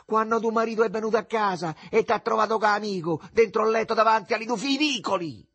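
loud, high-pitched accusing tirade; piercingly high shout. A narrator's reading. Mother exposes the neighbour's affair, shouting very high. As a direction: keep screeching the accusation. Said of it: "gridò con voce acutissima".

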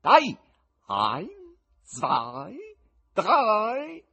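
loud, high gleeful call; shouting to the cat. A narrator's reading. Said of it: "gridò al gatto".

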